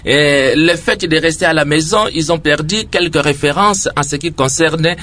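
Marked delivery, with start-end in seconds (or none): none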